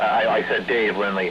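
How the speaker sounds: usual speaking voice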